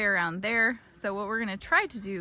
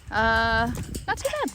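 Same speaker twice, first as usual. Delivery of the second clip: loud triumphant call; excited cheer; 2 semitones higher, 7 dB louder than usual. Real, not acted. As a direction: celebrate out loud. Cheering after herding success.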